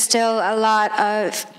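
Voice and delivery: throat catching on swallowed water; clearing a throat after water went down wrong; choking on water. Real, not acted. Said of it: "that water went down the wrong way".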